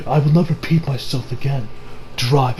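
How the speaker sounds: low, soft, menacing Dio impression; cold villain command. Dio ordering the senator to drive, cold quiet command. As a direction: drop low and quiet, utterly in control.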